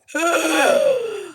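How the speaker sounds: sharp high gasp, recalling the shock; retold shocked gasp. Recalling her friend's message about Andrew, a sharp gasp. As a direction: gasp as the news sinks in again.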